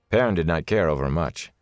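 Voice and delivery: plain reading voice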